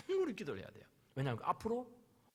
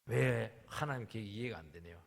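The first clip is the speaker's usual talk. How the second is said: complaining to God; 8 semitones lower, slower than usual. Retold or imitated. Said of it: "그전에는 뭐".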